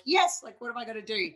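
high-pitched eager "yes"; eager retold reply. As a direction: jump in keenly, pitch leaping up then easing. Retold eager answer to coach.

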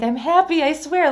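retold embarrassed reassurance; earnest protest. Reassuring server despite tears.

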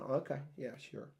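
very soft, doubtful mumbling; skeptical brush-off. Acted. Doubters answering someone's big plan, skeptical brush-off. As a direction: trail down into unconvinced agreement. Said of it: "they're all kinda sitting back going".

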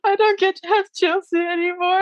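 high, whiny mock lament; mock heartbroken whine. To her coach, a high mock wail over losing her. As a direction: pitch it way up like a sad kid. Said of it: "I was so heartbroken".

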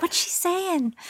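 high, puzzled mock child voice, pitched well up; puzzled kids. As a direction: play a baffled kid who can't follow the accent. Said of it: "They'll be like".

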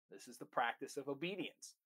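ordinary talk

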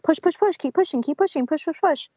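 high, insistent push push from a pushy midwife; forceful nurse. Retold or imitated. Retelling the midwife drilling her to push, forceful. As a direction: bark the orders like a nurse on your case. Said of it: "being really forceful with me".

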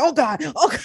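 retelling the mixing disaster in mock panic. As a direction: panic as the dough flies. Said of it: "I'm like".

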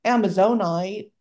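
Amazonite drawled with a long Southern o, AMA-zone-ite; Southern accent. Shown as a demonstration. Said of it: "I always wanna be like".

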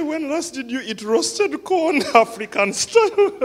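amused retold question; laughing. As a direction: quote bubbling with laughter. Pastor retells gift with laughter.

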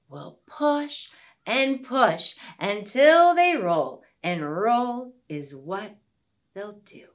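sing-song rhyming recital; poem recital. Acted. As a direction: read it like a children's poem. Finger play poem continued.

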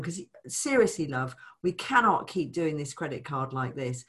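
ordinary talk

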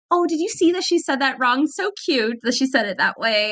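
high, teasing imitation of gossiping classmates; mocking classmates. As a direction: put on a high, sing-song voice mocking the new girl. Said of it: "they'd be like".

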